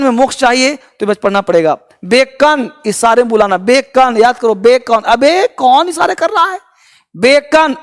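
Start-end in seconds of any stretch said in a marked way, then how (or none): 5.0-6.6 mock rude retort. Memory trick joke.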